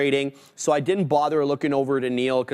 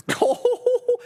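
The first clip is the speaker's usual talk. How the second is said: bursts into a high laugh. Real, not acted. Laughs at breakout.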